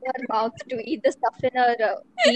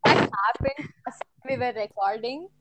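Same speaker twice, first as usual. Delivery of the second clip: normal talking voice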